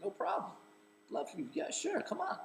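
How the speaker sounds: high, airy, casual invite; breezy celebrity. Retold or imitated. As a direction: wave him in carelessly. Imitating Charlie inviting him.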